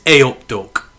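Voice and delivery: a hint of a Midlands accent; Midlands greeting. Shown as a demonstration. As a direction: give it the local Midlands lilt, lightly. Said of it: "We call each other Duck here".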